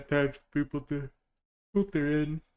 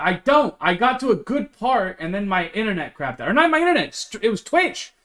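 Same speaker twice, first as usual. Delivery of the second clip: louder and higher, indignant and fired up; excited protest; 7 semitones higher, 5 dB louder than usual. Real, not acted. Streamer denies to chat he hates the game, excited and loud. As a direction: fire back at chat, half laughing in protest.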